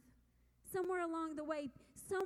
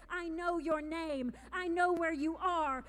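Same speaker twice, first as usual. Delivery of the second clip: powerful; 8 dB louder than usual. Acted. Voicing God speaking to them.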